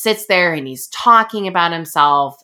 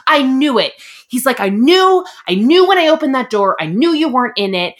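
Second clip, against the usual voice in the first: mildly worked-up mock rant, pitched higher; retold rant; 7 semitones higher, 3 dB louder than usual. Woman retelling her date's reaction to rejection, mock rant. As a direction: imitate a date's indignant outburst. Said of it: "he goes on this rant".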